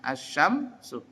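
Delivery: "asyamsu" slow with the sh doubled. Shown as a demonstration. Teacher slowly modelling "asyamsu" for students. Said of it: "seperti asyamsu".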